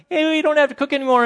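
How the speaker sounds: high, loud mock-ecstatic cry; over-the-top commercial elation; wildly excited. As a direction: burst out in hammed-up joy. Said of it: "it's all exciting".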